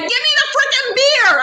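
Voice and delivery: high, pushed cry for the beer; frantic demand. Retold or imitated. Demanding a beer from the guys, in a high frantic squeal. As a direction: squeal it in grossed-out panic.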